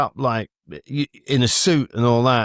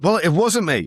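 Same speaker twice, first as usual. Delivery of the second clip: ordinary talk, nothing marked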